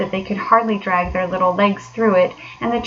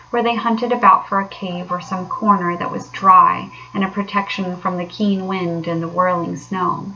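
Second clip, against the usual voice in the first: usual speaking voice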